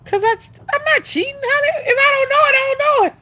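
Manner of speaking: high-pitched, fast indignant protest. Real, not acted. Denying cheating to the person accusing her, high and fast. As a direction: squeal it out, insisting you're not cheating.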